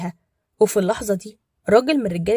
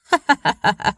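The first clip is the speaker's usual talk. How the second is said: mocking laugh; 6 dB louder than usual. A narrator's reading. Hamed mocks Badr.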